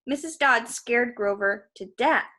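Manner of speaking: ordinary delivery, nothing marked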